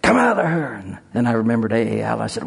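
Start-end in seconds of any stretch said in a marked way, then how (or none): 0.0-0.7 high forceful command to the devil; commanding shout. Retold or imitated. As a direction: order the spirit out, ringing high. Deliverance command retold.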